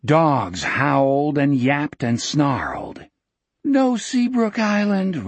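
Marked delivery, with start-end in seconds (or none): 3.7-5.3 put-on high, plaintive child voice; high-pitched sleep-talking plea; dreaming boy. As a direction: protest feebly from inside a nightmare. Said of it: "Richard was muttering in his sleep".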